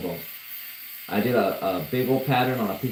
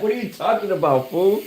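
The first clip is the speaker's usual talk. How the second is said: baffled; 9 semitones higher, 3 dB louder than usual. Retold or imitated. Retold baffled reply on the phone.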